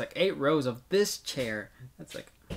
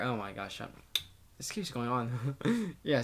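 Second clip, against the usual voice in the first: usual speaking voice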